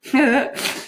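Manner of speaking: high, silly little giggle; short dopey giggle; girlish giggle. A narrator's reading. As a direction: giggle at your own joke. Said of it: "Juliet giggled".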